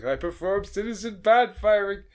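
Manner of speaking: loud, high mock-grand announcement; dramatic proclamation. Reenacting a cartoon bandmate's big threat, loud and high. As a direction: declare it like a melodramatic villain. Dramatic quote over a musical sting.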